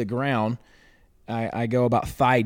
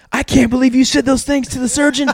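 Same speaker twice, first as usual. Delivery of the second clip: high, loud scandalized sister voice, imitated; mock the shocked big sister, pitched way up; imitating a prim sister's horrified scolding; mimicking sister; 11 dB louder than usual. Sister scolding in retold story.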